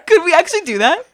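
high, loud, giggly questions; excited laughing. Real, not acted. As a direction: ask through excited laughter.

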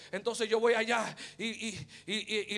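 normal talking voice

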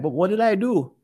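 high, pleading confusion; bewildered husband. Retold or imitated. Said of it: "you're like".

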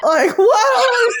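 high, loud disbelief; indignant shout. Acted. Host ranting about being typecast over French, indignant shout. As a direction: burst out in exaggerated outrage. Mock outrage at French remark.

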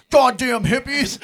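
loud, drawn-out mock angry yell; mock outrage. Friends mad at a painted hippie sign, mock outraged yell. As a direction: holler it in hammed-up disgust.

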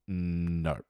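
low, soft, held-back no; quiet refusal. Retold or imitated. Diner turns down chicken hearts at dinner, quiet refusal. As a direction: drop it low and hushed.

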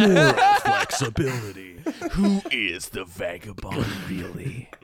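hammed-up, whispery true-crime teaser; true crime narrator. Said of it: "like, on those, like, true crime shows".

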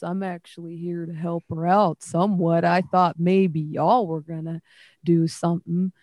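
a friendly, slightly scolding sea creature; tortle creature voice. Acted. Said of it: "he, like, says to you".

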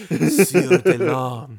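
slow, drawn-out perfume ad; cologne commercial voice. Retold or imitated. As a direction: purr it like a cologne ad. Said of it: "some cologne commercial".

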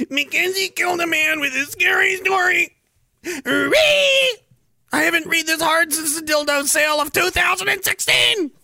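high-pitched comic yelling; hammed-up shouting. Host cheering a man killed by scary story, hammed-up shout. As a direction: crow it high, like mock celebration.

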